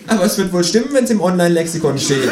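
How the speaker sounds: high, sly kangaroo; high kangaroo voice, smug. A narrator's reading. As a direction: tease innocently.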